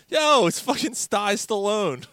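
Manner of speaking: high, loud, drawn-out mock announcement; blurting out a joke nickname; acted-out exclamation. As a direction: crow it out slowly and loudly. Teller acts out his mocking outburst.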